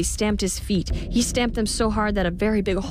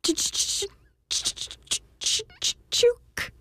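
stuttering guess; slower than usual. A narrator's reading. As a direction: stammer to a name.

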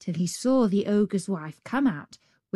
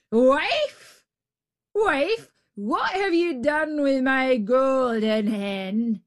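ogre shouting; 5 semitones higher, slower than usual. Acted. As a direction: bellow for the wife. Ogre calling out angrily.

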